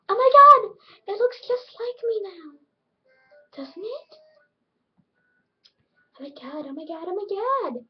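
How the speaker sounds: high, gushing mock delight; annoying opposite self. Opposite self admiring the edited look, hammed-up gushing. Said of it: "how annoying my opposite self was".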